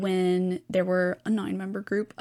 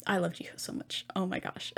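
whispered gush; 7 dB quieter than usual. Real, not acted. Gushing about a favourite idol.

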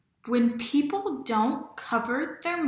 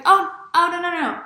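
flustered denial; 8 semitones higher, 8 dB louder than usual. Retold or imitated. Retelling her flustered denial.

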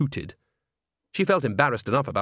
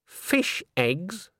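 cold, higher-pitched query; 7 semitones higher than usual. A narrator's reading. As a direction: question it with icy disapproval. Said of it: "said Granny coldly".

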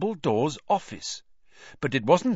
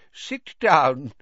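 put-on old man's voice, a little louder; feeble old headmaster; 3 semitones higher, 5 dB louder than usual. A narrator's reading. Old headmaster offers the boy a seat, feeble voice. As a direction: invite a pupil in as an aged wizard.

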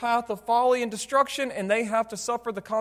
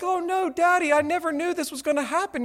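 high-pitched mock whining kid; crying child; 8 semitones higher than usual. Preacher imitating his burned child appealing to Daddy, mock crying voice. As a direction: put on an upset little kid's voice, no real sobs. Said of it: "then they start crying".

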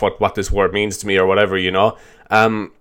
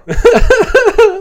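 laugh; 7 dB louder than usual. Real, not acted. Laughing at himself.

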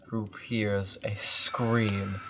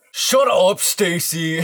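put-on much higher, louder teen yell; teen shout; 9 semitones higher, 15 dB louder than usual. Voicing Jason yelling.

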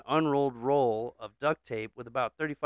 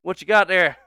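loud, higher Southern call; old man's holler; 6 semitones higher, 10 dB louder than usual. Acted. Old man calling to passing boy, hollered.

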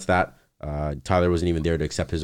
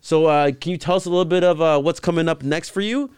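high, loud gushing interviewer imitation; imitated eager reporter; mock interviewer; 10 semitones higher, 5 dB louder than usual. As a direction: pitch it up like an earnest red-carpet host. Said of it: "She's saying".

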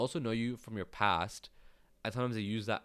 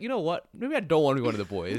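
mock friend's voice; 5 semitones higher, 7 dB louder than usual. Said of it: "[name]'s like".

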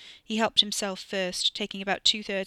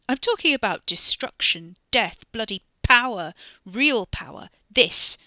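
louder, rising menace, each word pushed harder; menacing boast; 2 semitones higher, 9 dB louder than usual. Acted. Monster cousin gloats about power over dinner, building loud.

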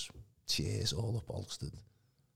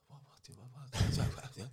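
imitating gibbering; 4 semitones higher than usual. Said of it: "I was gibbering again".

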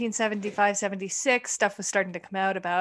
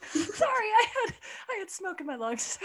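coughing laugh with smoke in lungs; giggling through a lungful of smoke; hiccup giggles; 10 semitones higher than usual. Real, not acted. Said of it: "Stop making me laugh when I got smokes".

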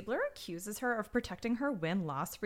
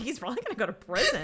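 laughing; 5 semitones higher, much faster than usual. Real, not acted. Laughing through retold quote.

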